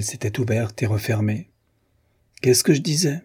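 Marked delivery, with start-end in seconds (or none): 2.4-3.2 sneering triumph, pitched up; malicious I-told-you-so; nasty sneer. A narrator's reading. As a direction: gloat with a mean edge. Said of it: "avec un rire mauvais".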